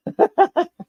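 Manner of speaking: short high laugh. Real, not acted. Laughing after admitting to viewers they ran out of bearings.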